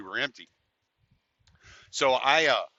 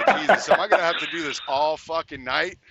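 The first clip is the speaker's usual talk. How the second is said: laughing, louder disbelief; laughing exasperation; 3 semitones higher, 7 dB louder than usual. Retold or imitated. Tired driver laughs at more girls coming, louder. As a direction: laugh through the complaint.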